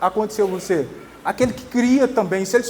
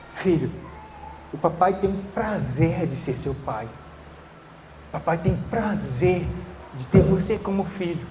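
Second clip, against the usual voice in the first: put-on soft, lower, loving words to a child; gentle fatherly pride; tender father; 3 semitones lower, 5 dB quieter than usual. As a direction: say it to your son with quiet delight. Father telling child his delight.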